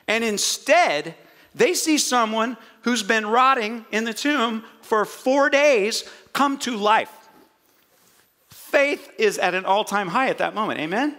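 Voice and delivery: ordinary talk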